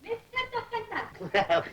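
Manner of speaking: high, soft, slow call; coaxing singsong call; drawn-out call. Acted. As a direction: stretch the name out high. Calling out, drawing out the name.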